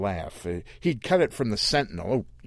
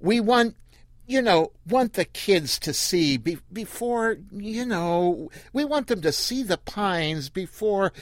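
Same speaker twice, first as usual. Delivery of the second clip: much higher, gushing and halting; chattering tourist mom; 10 semitones higher than usual. Acted. The woman telling Earl why they came, much higher. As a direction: explain eagerly to a stranger.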